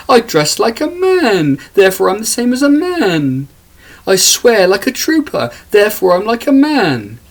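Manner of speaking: mock them in a put-on high voice; mocking women's voice. Man mocking women claiming sameness with men, high parody voice. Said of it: "because women are the same as men".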